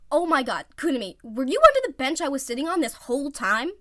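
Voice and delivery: loud incredulous question; shocked. Acted. Iwazumi to Kunimi under the bench, loud disbelief. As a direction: disbelief rising.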